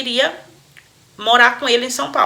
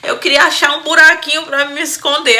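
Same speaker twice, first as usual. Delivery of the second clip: higher, loud line through giggles; laughing; 5 semitones higher, 6 dB louder than usual. Real, not acted. Host still laughing, wanting to hide, loud and high. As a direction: laugh through every word. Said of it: "eu tô rindo aqui".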